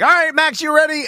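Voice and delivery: put-on high eager call to a kid; excited. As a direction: cheer the boy on. Excited parent to son.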